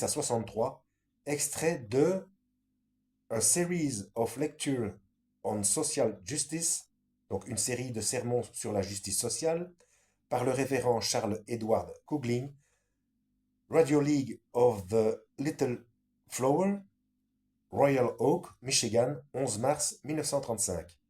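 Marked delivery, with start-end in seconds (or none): 3.3-6.9 put-on English title in a French accent, slowed. Reading the English source title to viewers, French-accented English. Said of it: "mon accent anglais devient moins moche". 13.7-19.2 put-on louder English place names in a French accent. Citing the English radio source, louder French-accented English. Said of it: "mon accent anglais devient moins moche".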